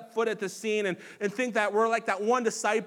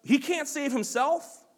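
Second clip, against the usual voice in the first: scoffing; 7 semitones higher, 5 dB louder than usual. A narrator's reading. Priests scoffing at Jesus on the cross, high mocking sneer. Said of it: "they scoffed".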